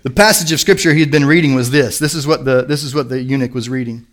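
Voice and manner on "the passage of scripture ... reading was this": usual speaking voice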